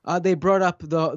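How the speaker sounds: normal talking voice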